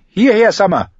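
high, loud call; shouting. Acted. Bran shouts for his wolf Summer, high and loud. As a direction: call the wolf home at full voice. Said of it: "schrie Bran".

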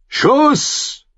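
high battle shout; shouted command. A narrator's reading. Commander orders archers to shoot, shouted.

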